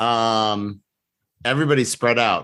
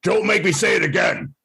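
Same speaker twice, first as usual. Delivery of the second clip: hobgoblin threat; 10 semitones higher than usual. Pretend. Hobgoblin commander threatens goblins.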